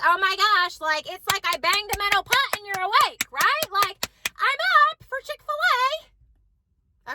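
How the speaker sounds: high-pitched mock eagerness, stretched at the end; hammed-up sudden wide-awake excitement; excited imitation. Voices son perking up at food.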